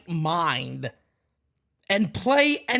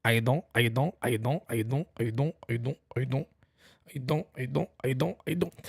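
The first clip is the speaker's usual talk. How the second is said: lower, softer, repeated stutter bit; playful stuttering voice, softer; mock stammer; 4 semitones lower, 5 dB quieter, faster than usual.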